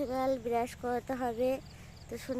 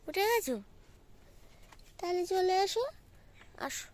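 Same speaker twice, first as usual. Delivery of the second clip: high, raised calling voice; 7 semitones higher than usual. Real, not acted. Mother calls her child to come to the paddy field. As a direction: call the little one over, pitched up.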